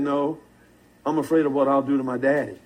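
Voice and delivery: dropped low and soft, a sly deadpan reply; low quiet knowing punchline. Retold or imitated. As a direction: say it low and quiet, then speed up at the end.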